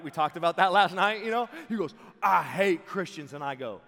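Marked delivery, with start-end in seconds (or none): 2.2-3.3 loud, slow declaration of hate; hostile outburst. Retold or imitated. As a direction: throw it out loudly, stretching the words. Repeats the mohawk man's confrontation.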